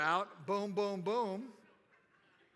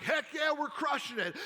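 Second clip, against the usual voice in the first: excited; 5 semitones higher, 3 dB louder than usual. Retold or imitated. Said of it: "they're like".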